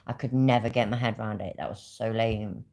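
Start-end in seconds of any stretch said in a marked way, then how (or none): none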